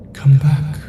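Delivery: high, ghostly call from far away; uncanny voice calling from the woods; eerie summons. A narrator's reading. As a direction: lure him back, sweet and hollow. Text from the unknown number.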